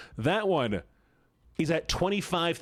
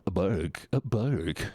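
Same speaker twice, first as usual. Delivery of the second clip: ordinary talk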